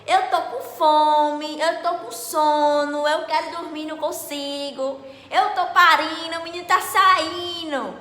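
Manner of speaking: loud, high wailing with stretched-out words; acted-out screaming. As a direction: holler each complaint long and loud like a woman in labour. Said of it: "Comecei a gritar". (gritando alto e arrastado, encenando o parto)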